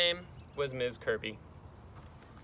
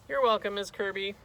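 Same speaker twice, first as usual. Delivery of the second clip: put-on higher little-boy voice; high-pitched, polite kid; polite schoolboy; 6 semitones higher, 4 dB louder than usual. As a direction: answer the teacher as a small boy. Boy answers teacher.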